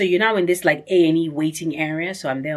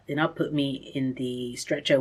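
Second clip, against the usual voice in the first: ordinary delivery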